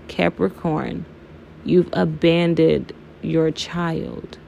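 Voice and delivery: slow, measured quoted reading; retold verdict, drawn out with pauses; solemn pronouncement. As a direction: deliver it slowly, spacing the words. Quoting the card reader's weighty pronouncement.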